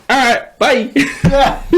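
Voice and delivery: high, giggly, drawn-out bye; laughing goodbye. Retold or imitated. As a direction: laugh your way out the door, slow. Kid cheerfully leaving detention.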